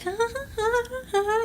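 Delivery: high, soft imitated children's cackle; kids cackling. Voicing the little kids heard in her apartment, soft high cackle. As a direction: mimic little kids' evil giggling.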